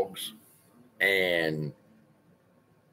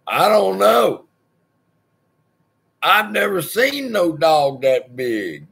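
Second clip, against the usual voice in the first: higher, louder dog's voice, then slow; voicing his dog; 7 semitones higher, 5 dB louder than usual. Landlord voicing his own dog Boomer, baffled. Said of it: "Boomer's looking at me going".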